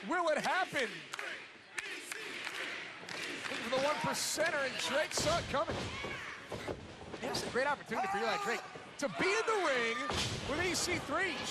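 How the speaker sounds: lively ringside play-by-play; wrestling commentator. Acted. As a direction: call the match as it happens. Live play-by-play.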